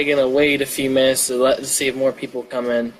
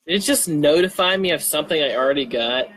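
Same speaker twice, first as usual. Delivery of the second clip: ordinary delivery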